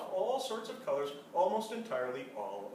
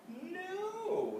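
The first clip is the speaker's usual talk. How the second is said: a high, sing-song no; high mother no. A narrator's reading. Mother brushing off child's question with a high no. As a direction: chirp it back brightly.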